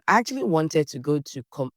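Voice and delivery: ordinary delivery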